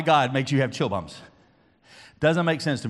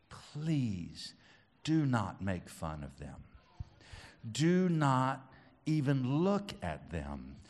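soft, low, deliberate appeal; earnest hushed plea; 4 semitones lower, 7 dB quieter, slower than usual. Real, not acted. Preacher asking kids not to mock those praying, earnest plea. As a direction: plead gently and slowly, with weight.

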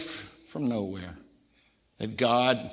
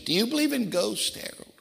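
put-on higher-pitched old elder asking; J T Pugh; 10 semitones higher than usual. Elder asking young Harold about ghosts, higher voice. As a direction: lift the voice up high for the questioning elder. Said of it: "an elder years ago named J T Pugh".

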